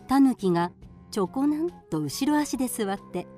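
ordinary delivery

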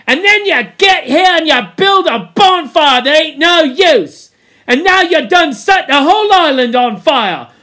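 high, loud ranting; Piggy's angry tirade. A narrator's reading. Piggy blames the boys for the fire, loud angry rant. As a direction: keep railing at them till the words run out. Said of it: "listening to his tirade".

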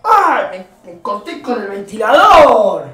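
shouts in sudden pain, slowed down; yelping after cutting a hand on the fan; real pained cry after a cut. Real, not acted.

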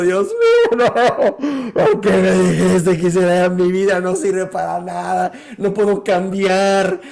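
high, loud wailing plea to God; a man sobbing in a sea of tears, begging God why; acted despair. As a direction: cry out to God in despair, voice breaking with sobs. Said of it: "en un mar de llanto".

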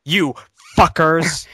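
loud high mock yell; shouting. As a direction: bellow it out. Mock outburst at friends.

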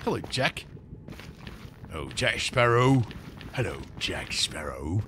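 low, soft pirate hello; lightly voiced pirate greeting. Streamer greeting a villager named Jack, low pirate voice.

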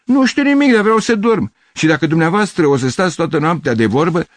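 very high, loud complaint; fed-up, strained outburst; exasperated shouting. Acted. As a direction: shout at people keeping you from sleep. Sleepless neighbour snaps back.

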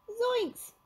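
very high cartoon fright; scared, high-pitched sidekick; Shaggy yelping. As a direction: yelp it high, in alarm. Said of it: "Shaggy yelped".

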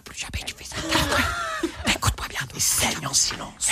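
harsh stern whisper. Retold or imitated. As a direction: scold him under your breath. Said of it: "en train de le taper en chuchotant".

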